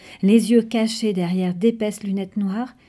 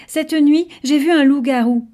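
breathless excitement; 6 semitones higher, 7 dB louder than usual. Acted. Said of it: "tout essoufflé".

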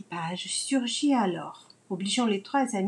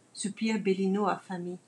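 ordinary delivery

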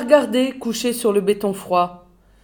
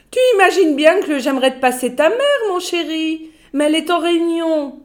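high, loud, sugary office voice; chatty secretary; 8 semitones higher, 4 dB louder than usual. A narrator's reading. Secretary brushing off the boss's son, high and loud. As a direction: coo at him while filing your nails. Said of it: "C'était Grunda, la secrétaire".

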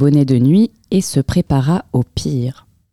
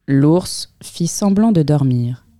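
ordinary delivery, nothing marked

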